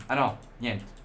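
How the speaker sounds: ordinary talk, nothing marked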